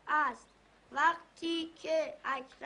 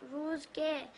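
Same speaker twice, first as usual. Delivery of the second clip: schoolbook reading; 4 dB quieter than usual. Acted. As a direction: sound out the primer line by line. Boy reads lesson aloud.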